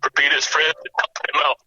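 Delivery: real choked-up grief, halting. Real, not acted. Nephew thanking his late uncle's friends on air, choked up. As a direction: speak through a lump in the throat. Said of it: "I'm sorry I've been choked up".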